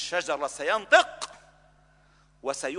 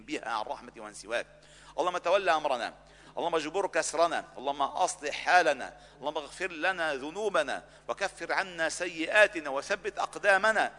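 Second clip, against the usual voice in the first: fervent prayer; 3 semitones lower, 4 dB quieter than usual. Acted. Imam leading the congregation in closing dua, fervent prayer. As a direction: plead with God on behalf of the congregation. Imam's closing supplication, rising fervour.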